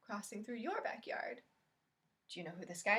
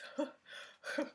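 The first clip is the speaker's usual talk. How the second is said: breathless laugh burst; a laugh that steals the breath; gasping laugh; 5 semitones higher, 3 dB quieter than usual. A narrator's reading. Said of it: "gasped the rhinoceros".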